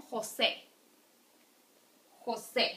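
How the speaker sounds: "José" with a strong final "sé"; last syllable stressed. Shown as a demonstration. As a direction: land on SÉ in "José". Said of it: "the strong one falls in the last syllable".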